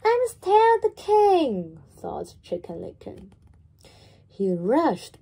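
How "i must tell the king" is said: high and loud, breathless urgency; urgent chick. Acted. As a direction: blurt it out in a hurry. Panicked chick decides to warn.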